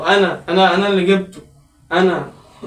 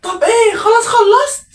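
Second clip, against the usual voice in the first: impatient shout; 12 semitones higher, slower than usual. Acted. Mother shouting through the bathroom door to hurry up. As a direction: yell to hurry him up.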